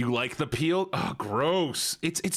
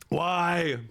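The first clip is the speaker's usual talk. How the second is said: ordinary delivery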